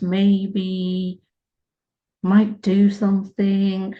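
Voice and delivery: slow, dawdling delivery with long pauses between maybes; sluggish rhythm. Shown as a demonstration. Mocking how slowly the connection has moved, slow and sarcastic. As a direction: drag it out sarcastically to show how slow things are. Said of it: "You get the rhythm".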